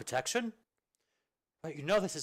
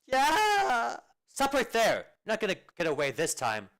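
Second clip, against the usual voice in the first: high, loud yell after a runaway; furious chasing shout; angry shout; 11 semitones higher, 9 dB louder than usual. Acted. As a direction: bellow at him to stop. Chasing a fleeing soldier.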